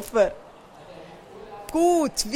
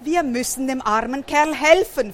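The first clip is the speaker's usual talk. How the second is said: usual speaking voice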